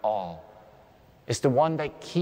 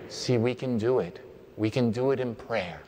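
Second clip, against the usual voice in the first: ordinary talk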